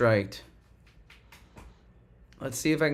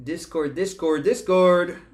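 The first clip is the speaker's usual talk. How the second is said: calling out; 6 semitones higher, 6 dB louder, slower than usual. Real, not acted. Calling out to his Discord.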